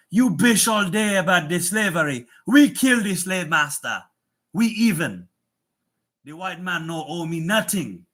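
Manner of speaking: put-on loud, high-pitched Haitian accent. Streamer mimicking Haitians he knows, loud mock Haitian accent. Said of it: "The Haitians I know are like".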